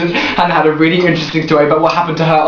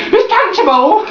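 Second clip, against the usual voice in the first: high prim cry; mock prim exclamation; 12 semitones higher, slower than usual. Mocking her prim friend's objection to swearing, high prim cry. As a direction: squeak it like a shocked prude. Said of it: "you don't go".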